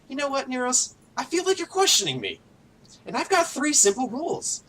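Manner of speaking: high-pitched, menacing confrontation; menacing captain. Acted. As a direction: confront an underling who dared question you. Captain cuts off and confronts underling.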